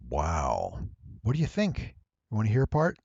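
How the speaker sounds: ordinary talk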